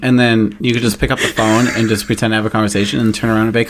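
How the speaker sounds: ordinary delivery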